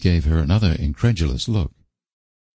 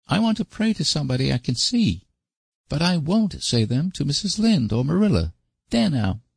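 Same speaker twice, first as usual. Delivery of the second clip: put-on high, fast boyish insistence; stubborn little boy; 10 semitones higher than usual. A narrator's reading. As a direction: dig in like a stubborn child. Davy continues objecting.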